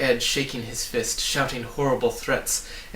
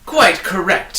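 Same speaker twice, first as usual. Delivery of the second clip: much louder and higher, gloating cry; triumphant shriek; 7 semitones higher, 12 dB louder, slower than usual. A narrator's reading. Trivium gloating over the trapped travellers, shrieking. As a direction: crow it out in victory. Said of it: "he shrieked triumphantly".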